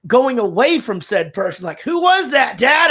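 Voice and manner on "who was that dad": high, loud kid imitation; nosy child. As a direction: call out like an insistent child. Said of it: "they will even ask me".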